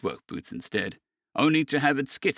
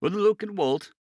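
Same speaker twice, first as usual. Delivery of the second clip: ordinary delivery, nothing marked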